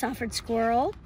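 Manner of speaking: usual speaking voice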